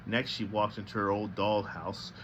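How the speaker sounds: ordinary delivery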